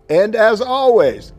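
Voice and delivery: slow, big rallying sign-off; emphatic sign-off. Real, not acted. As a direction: stretch it out like a rally cry. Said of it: "gotta get this one".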